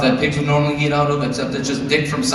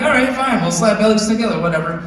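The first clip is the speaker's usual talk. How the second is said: playful dad; 7 semitones higher than usual. Retold or imitated. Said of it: "I'm like".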